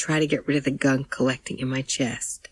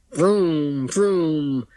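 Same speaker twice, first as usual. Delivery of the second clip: drawn-out child's engine imitation; pretend car noise; 4 dB louder than usual. A narrator's reading. Andrew between his parents pretending to drive, imitated engine noise. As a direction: play at revving a car engine. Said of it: "pretending to drive and going".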